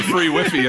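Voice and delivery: "Wi-Fi" said as "wiffy"; "Wi-Fi" with short first vowel, rhyming with "iffy"; mispronounced Wi-Fi. Retold or imitated. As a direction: read "Wi-Fi" as "WIFF-ee". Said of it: "Sir, did you mean free Wi-Fi?".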